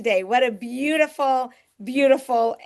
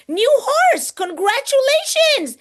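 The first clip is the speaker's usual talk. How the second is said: much higher and louder, thrilled; excited squeal; 13 semitones higher, 5 dB louder, slower than usual. Real, not acted. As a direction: squeal with delight at the news. Excited reaction to chat news.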